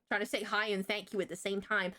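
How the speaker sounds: ordinary delivery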